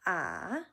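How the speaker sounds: "ah" dipping down then back up; full third tone. Shown as a demonstration. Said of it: "a little bit of a gravelly spot".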